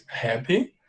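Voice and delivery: ordinary delivery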